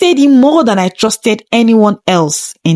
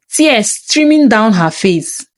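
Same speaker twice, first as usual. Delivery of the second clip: plain reading voice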